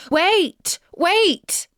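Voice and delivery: high, loud calling "wait, wait"; frog calling out. A narrator's reading. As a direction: shout after the running princess. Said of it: "to scream his croak".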